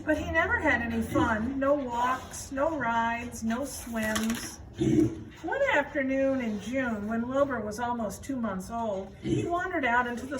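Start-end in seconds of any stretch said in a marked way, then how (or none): none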